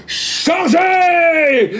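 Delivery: captain yelling charge to his crew; pirate captain's shout. Acted. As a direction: roar the order across the water. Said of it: "Et vous entendez crier".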